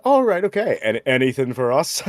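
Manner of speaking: piqued interest. Retold or imitated. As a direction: imitate someone perking up with curiosity. Retold shop assistant, suddenly interested.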